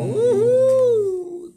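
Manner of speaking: high squealed whoop; excited cheer. Real, not acted. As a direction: cheer it like great news. Cheering being a couple now.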